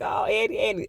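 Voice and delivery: high, mock-weepy sympathy; retold teary whimper; tearful. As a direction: coo the name as if about to cry. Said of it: "I teared up a little bit".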